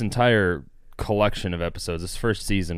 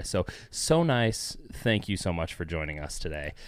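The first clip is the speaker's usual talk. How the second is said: soft, gentle thank-you; 6 dB quieter than usual. Real, not acted. Host thanking listeners for joining, gentle voice. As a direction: drop into a softer, kinder tone. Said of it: "hear his voice go all gentle".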